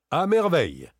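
high, loud burst of delight; delighted exclaim. A narrator's reading. Daspry, pleased with the deal, exclaims in delight. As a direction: cry it out, enchanted with the outcome. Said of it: "s'écria Daspry d'un air enchanté".

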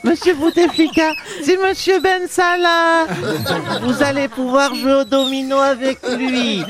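hammed-up presenter. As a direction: announce him like a circus act. Presenting old man comically.

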